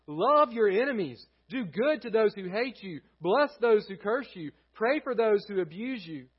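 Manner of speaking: high, loud preacher's reading; ringing, emphatic Bible reading; forceful scripture reading. Real, not acted. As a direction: proclaim the verses to a congregation, punching key words. Reads Bible passage to congregation.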